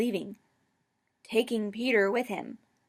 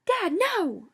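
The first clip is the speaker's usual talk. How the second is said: much higher, louder panicked scream; 12 semitones higher, 7 dB louder than usual. A narrator's reading. Daughter screaming at Dad to stop, much higher and louder. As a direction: yell to stop him. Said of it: "I screamed".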